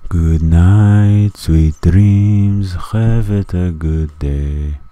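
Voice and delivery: loud sing-song goodnight wishes; loud mock goodnight. Gamer loudly wishing viewers goodnight as his character sleeps. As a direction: call out a cheery goodnight.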